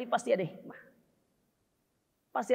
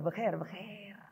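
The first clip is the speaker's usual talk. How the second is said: Sasak-style Arabic; 4 semitones lower, 3 dB louder, slower than usual. Retold or imitated. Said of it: "bahasa Arabnya orang-orang Sasak sana".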